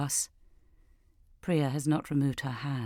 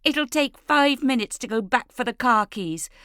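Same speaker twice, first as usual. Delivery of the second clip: high, loud call of a name; shouting; 8 semitones higher, 6 dB louder than usual. Acted. Leah shouts to her sister Jess. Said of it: "Leah is shouting".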